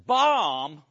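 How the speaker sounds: "Bomb?" laughed out with a rising, disbelieving tune; incredulous laugh. Real, not acted. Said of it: "you know how I'm feeling".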